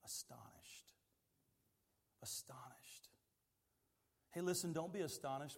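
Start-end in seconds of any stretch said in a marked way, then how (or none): 0.1-0.9 hushed whispered answer. Acted. Answers own question in whisper. 2.2-3.1 soft unvoiced repeat; whisper.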